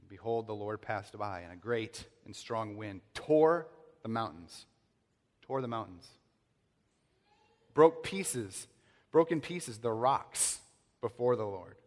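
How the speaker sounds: slow, weighty reading that swells on the storm; dramatic scripture reading. Acted. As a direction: read it like thunder rolling.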